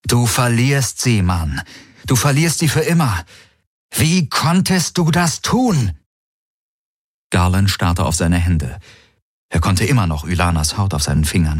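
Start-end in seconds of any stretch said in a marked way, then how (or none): 0.1-5.9 desperate, climbing higher; anguished self-reproach. A narrator's reading. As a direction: scold yourself in rising despair. Shouting at himself while hitting himself.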